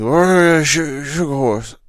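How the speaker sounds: hammed-up coworker drawl. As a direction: boom it out like the new guy. Coworker voiced in story.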